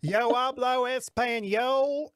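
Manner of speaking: slow, high mock Southern drawl on Spanish; strong Southern accent. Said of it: "such a strong accent".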